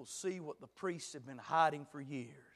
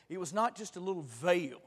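ordinary talk

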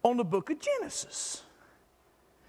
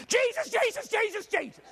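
exasperated repetition; 12 semitones higher than usual. Retold or imitated. Man complaining he only thinks of Jesus, exasperated. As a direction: hammer the name over and over.

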